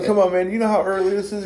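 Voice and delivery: high, loud mock exasperation; played-up indignant protest; exasperated complaint. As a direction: complain at an early-morning caller. Acted annoyance at early phone call.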